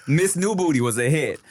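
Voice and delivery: usual speaking voice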